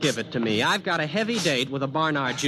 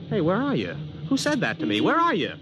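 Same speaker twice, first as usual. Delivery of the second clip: higher and higher, calling out to an unseen voice; bewildered calling out; 10 semitones higher than usual. Acted. Man searching the empty street for the unseen speaker, bewildered calling out.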